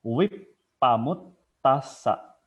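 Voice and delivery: Wipamut tasa drawn out slowly, each syllable separate; slow deliberate Pali syllables; slow clear syllables. Shown as a demonstration. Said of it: "kita harus clear ngucapinnya".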